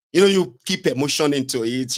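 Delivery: normal talking voice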